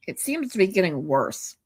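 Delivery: ordinary talk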